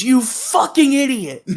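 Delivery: mock scream, pitched high and drawn out; hammed-up high-pitched yell, slowed right down; imitated scream. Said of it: "when he screams".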